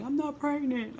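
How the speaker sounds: high mock-tearful whine; imitated crying. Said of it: "I'm just crying".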